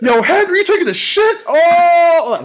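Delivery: loud, high, laughing yell; surprised shout. Retold or imitated. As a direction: shout it in shock through laughter. Friend's shocked call in bathroom.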